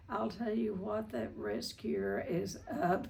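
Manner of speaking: normal talking voice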